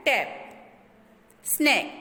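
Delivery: "टैप, स्नैक" with a short, open a; short A sound. Shown as a demonstration. Teacher shows students the short A, short open vowel in "टैप, स्नैक". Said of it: "ए की short vowel sound".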